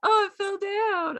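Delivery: hammed-up high mocking voice; mocking Thor. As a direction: sneer like a smug god knocking stones over. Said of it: "He's like".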